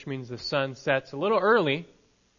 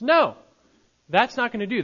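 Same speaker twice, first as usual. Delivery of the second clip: a higher, louder, firm no; emphatic refusal; 8 semitones higher, 7 dB louder than usual. A narrator's reading. Jesus firmly rejecting the disciples' proposal, emphatic and loud. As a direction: shut the idea down with a ringing no. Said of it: "Jesus emphatically rejects their proposal".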